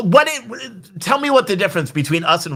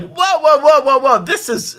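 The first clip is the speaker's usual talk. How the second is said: higher, louder imitation of shocked commentators; alarmed objection; 9 semitones higher, 4 dB louder than usual. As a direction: call a halt, mimicking startled pundits. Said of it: "commentators and legal scholars that have been like".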